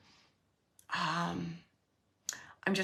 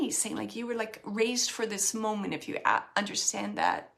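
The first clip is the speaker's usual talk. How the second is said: ordinary delivery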